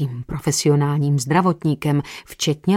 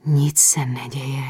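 hushed voice; 4 semitones lower, slower than usual. A narrator's reading. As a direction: lean close and whisper comfort. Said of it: "Stišila hlas".

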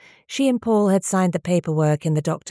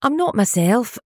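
tearful; 4 semitones higher than usual. A narrator's reading. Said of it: "starting to cry".

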